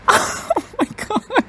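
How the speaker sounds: very high, drawn-out squeal; real breaking high-pitched gasp of shock; stunned shock. Real, not acted. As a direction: let it squeak out in disbelief. Shocked by the hunt on screen.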